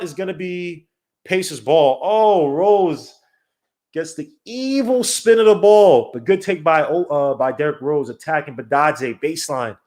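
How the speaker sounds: ordinary delivery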